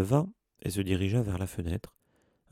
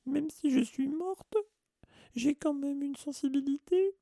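very high, wailing hurt feelings; sobbing. A narrator's reading. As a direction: wail it tearfully, very high. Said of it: "d'une voix sanglotante". (voix suraiguë et sanglotante)

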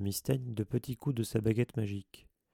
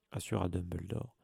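usual speaking voice

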